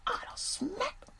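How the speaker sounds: soft whispered boast; whispered confession. Retold or imitated. The son quietly admitting to his dad that he hit back. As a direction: let it slip in a whisper.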